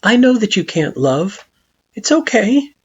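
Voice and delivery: calm him kindly; gentle Frisk. A narrator's reading. Frisk gently reassures Flowey.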